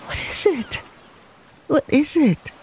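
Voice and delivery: high, fearful quaver; worried woman's trembling question. A narrator's reading. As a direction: ask anxiously in a small, high voice. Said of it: "quavered Lei Chu".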